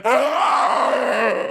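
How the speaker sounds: high acted scream of shock; long, high cry of alarm; horrified scream. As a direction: scream in mock horror. Beggar realises it is the king.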